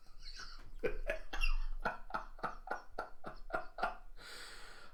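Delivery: long, quiet laughing fit; real laughter. Real, not acted. Narrator laughing at Kinemon's reaction face, real amused laugh. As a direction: crack up wordlessly.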